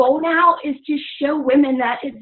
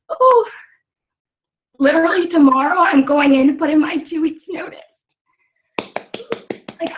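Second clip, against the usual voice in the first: tearful, higher-pitched voice; real tearful; 4 semitones higher than usual. Real, not acted. Coach telling her team about quitting her job, tearful. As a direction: fight through happy tears as it spills out. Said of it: "here come the waterworks".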